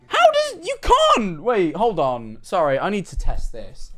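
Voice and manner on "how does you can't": high, loud disbelief; baffled protest. Real, not acted. Streamer puzzling over an animated character's walk, baffled protest. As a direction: protest loudly at an impossible sight, pitch way up.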